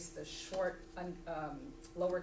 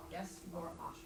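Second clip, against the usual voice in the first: usual speaking voice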